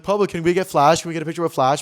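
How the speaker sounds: loud, eager calls; calling fans. Retold or imitated. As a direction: shout over the crowd for a photo. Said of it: "these people were like".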